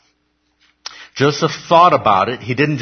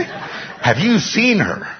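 high, drawn-out amazement; hammed-up smitten young man; mock awestruck; 9 semitones higher, much slower than usual. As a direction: stretch it out, pitched way up. Acting a young man with raging hormones.

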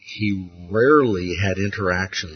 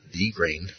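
THE set apart as "thee", quietly; soft but deliberate "thee" before grain; stress on THE; 4 semitones lower, 9 dB quieter than usual. Shown as a demonstration. Teacher inserting the corrected article, stressed THE. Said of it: "it would read more like this".

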